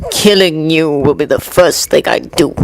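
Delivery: low menacing snarl of a villain; snarled threat. A narrator's reading. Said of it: "snarled the man".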